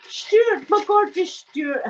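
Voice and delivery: high, drawn-out greeting of joy; slow, high, beaming hello; delighted greeting. Real, not acted. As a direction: welcome him like a long-lost friend. Greeting a visitor warmly.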